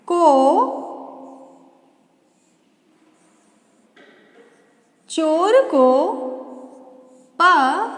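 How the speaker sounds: spaced words, drawn out; slow dictation. Shown as a demonstration. Teacher dictating a new sentence to children, slowly. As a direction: dictate each word with long pauses. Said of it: "और बनाते हैं।".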